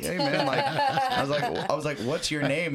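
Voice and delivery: can't keep a straight face telling it; laughing. Real, not acted. Retold, laughing while telling.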